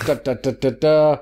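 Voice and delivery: vocal drum roll, da-da-da; suspense fanfare. Pretend. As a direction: build up to the big reveal. Drum roll before random commander reveal.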